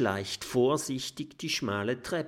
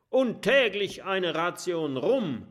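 calling out; 9 semitones higher than usual. Acted. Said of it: "ruft Kabeljau Kurt".

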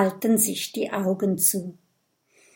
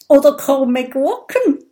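high, loud, amused suggestion; giggly; 5 semitones higher, 7 dB louder than usual. A narrator's reading. Little dragon jokes about crooked things, giggly. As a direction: toss it in with a touch of giggle. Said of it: "kichert Kokosnuss".

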